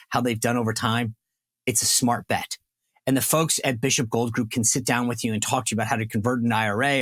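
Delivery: smooth podcast ad pitch, friendly and steady; ad read. Acted. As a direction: sell it like a host-read radio spot. Sponsor ad read mid-show.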